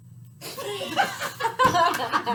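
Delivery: loud, helpless laugh breaking up the take; burst of laughter. Real, not acted. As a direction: crack up laughing mid-sentence. Bursts out laughing mid-recording.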